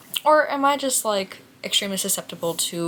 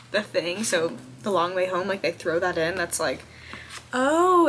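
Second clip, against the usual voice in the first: ordinary talk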